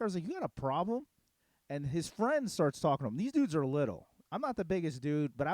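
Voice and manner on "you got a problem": retold challenge.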